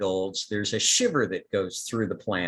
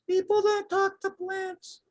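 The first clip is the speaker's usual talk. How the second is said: tiny plant voice. Acted. A tiny plant voice answering the gardener, very high. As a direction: go way up small and squeaky. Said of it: "one little tiny voice that goes".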